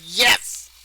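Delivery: triumphant yes. Retold or imitated. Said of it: "I'm just like".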